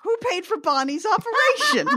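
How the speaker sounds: outraged rhetorical question; indignant. Real, not acted. Outraged at giving orphans away.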